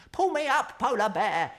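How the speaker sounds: high, loud urgent cry; a scared penguin calling up. A narrator's reading. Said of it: "he cried".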